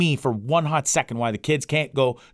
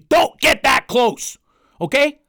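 slow, loud, much higher exasperated shout; 12 semitones higher, 7 dB louder, slower than usual. Real, not acted. Podcaster yelling at parents and officials, exasperated shout. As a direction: yell it at the fear-mongers, slowly. Ranting at Halloween cancellers.

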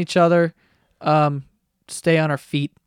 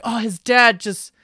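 higher, slower mimicking voice; put-on higher voice, louder toward the end; mock assuming gossip; 6 semitones higher, 5 dB louder, slower than usual. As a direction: imitate people jumping to conclusions, drawn out. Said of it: "people have been ... just making assumptions".

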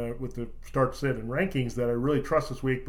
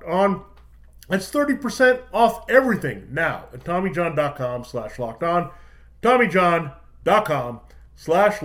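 upbeat, punchy ad read; holiday sale ad; 3 semitones higher, 6 dB louder than usual. Acted. Host-read Tommy John ad.

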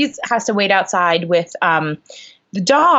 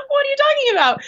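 high-pitched mock squeal of bafflement; incredulous retold squeak; shocked disbelief; faster than usual. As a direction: re-enact being floored, voice shooting way up. Acted shocked reply to audition question.

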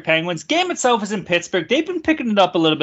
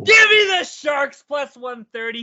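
excited shout; 9 semitones higher, 5 dB louder, slower than usual. Real, not acted. Hyped betting pick call.

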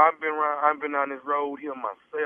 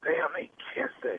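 unvoiced, slow secret whisper; 11 semitones higher, 6 dB quieter than usual. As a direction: whisper so the back seat can't hear. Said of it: "All this whispering".

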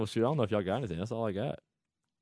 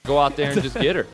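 higher, louder Canadian pep talk; Canadian accent; 4 semitones higher, 7 dB louder than usual. Retold or imitated. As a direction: pump up the team, Canadian style. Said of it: "my Canadian accent".

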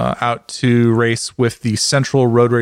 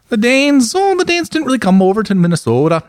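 a light Minnesota-Scandinavian lilt on the vowels; Minnesota accent; 7 semitones higher than usual. Pretend. Friend riffing on a Fargo trip in a light Minnesota accent. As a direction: play a folksy Upper Midwest local. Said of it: "Canadian accent with a little bit of Scandinavian".

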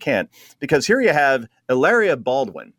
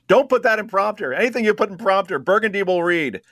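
higher-pitched, hurried movie-quote delivery; Anchorman line; 5 semitones higher than usual. Acted. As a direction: quote the film in a raised, urgent voice.

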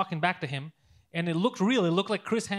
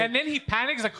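normal talking voice

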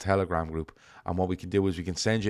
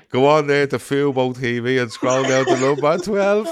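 mock mum voice; 4 semitones higher, 7 dB louder than usual. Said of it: "me ma wrote".

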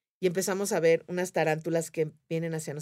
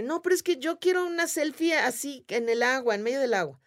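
high imitated pleading; pleading objection; 7 semitones higher than usual. Girl begging the leader for a selfie, high plea. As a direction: mimic a girl insisting on her wish.

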